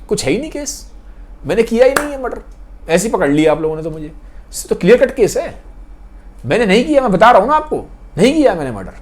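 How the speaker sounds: high, pressing protest; accused man impatiently pleading innocence to lawyers; agitated insistence. Acted. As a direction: urge them to finish the case.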